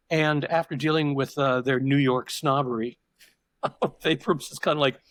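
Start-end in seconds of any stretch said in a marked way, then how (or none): none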